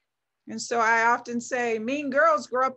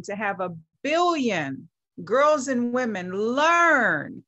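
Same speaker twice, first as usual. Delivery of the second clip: slow build, leaning hard on learn at the end; stress on learn; slower than usual. Real, not acted. Visionary addressing women, stressing the word learn. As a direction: punch the word learn with a falling tune. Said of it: "I'm going to emphasize the word learn".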